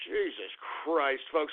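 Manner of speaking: slow, low, soft curse; weary exasperation. Real, not acted. Host addressing folks after ranting, weary low exasperation. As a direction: let it sink out slowly, fed up.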